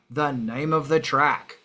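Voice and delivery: normal talking voice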